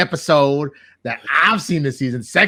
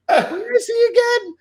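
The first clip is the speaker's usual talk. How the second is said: very high put-on voice; squeaky mock question. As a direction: pipe it up in a silly squeak. Retold character scene.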